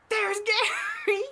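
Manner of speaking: loud, high, drawn-out laughing reveal; exasperated surprise. Retold or imitated. Vlogger revealing Gary sitting outside her door, exasperated surprise. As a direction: announce him loudly, stretching out the name.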